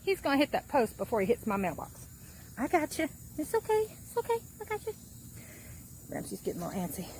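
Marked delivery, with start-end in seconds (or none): 2.6-4.9 soothing a scared dog. Real, not acted. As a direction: coo gently to calm him. Holding scared puppy, soothing.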